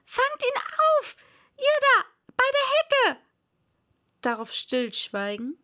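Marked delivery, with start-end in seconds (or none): 0.0-3.2 high, loud urgent shout; rabbit's voice. Acted. Rabbit ordering those by the hedge, high shouting. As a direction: yell orders across the garden in a high voice. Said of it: "die Stimme des Kaninchens allein".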